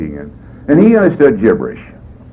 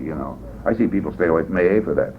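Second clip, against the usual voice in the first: usual speaking voice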